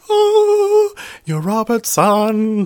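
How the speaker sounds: high, loud, slow swooning realization; mock starstruck gasp; thrilled gasp. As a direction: gush at the discovery. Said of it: "And I was like".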